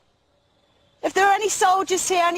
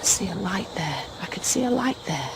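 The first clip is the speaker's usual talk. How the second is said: tense whisper in the dark; scared hushed whisper; 9 semitones lower, 5 dB quieter than usual. Real, not acted. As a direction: breathe it so nothing hears you. Alone in dark woods, ghost hunting.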